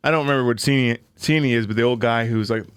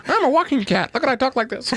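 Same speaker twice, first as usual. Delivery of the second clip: high squeaky cartoon kid voice; pinched voice; 12 semitones higher than usual. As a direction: squeak it like a silly child. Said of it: "I remember that voice".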